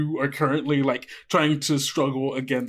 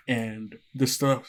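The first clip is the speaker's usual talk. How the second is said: normal talking voice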